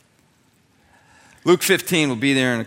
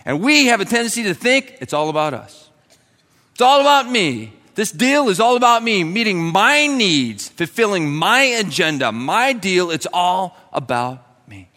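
rising, louder, slowing down on meeting my needs; preaching at full force; 5 semitones higher, 5 dB louder than usual. Acted. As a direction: drive it home like a preacher.

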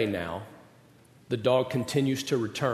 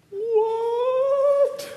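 very high, stretched out What; a child's long squeal of disbelief; mock kid squeal of shock; 3 dB louder than usual. Son shocked by the news.

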